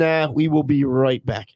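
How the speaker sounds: normal talking voice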